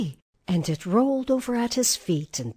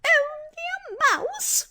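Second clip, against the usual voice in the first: high-pitched, slow indignant mouse; indignant squeak; 4 dB louder, slower than usual. A narrator's reading. Little mouse queen objects to being called only a mouse, indignant squeak. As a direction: squeak in affronted disbelief. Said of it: "cried the little animal indignantly".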